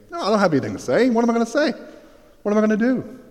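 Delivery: high, fast panicked inner voice. Retold or imitated. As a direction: blurt it out in a rising panic.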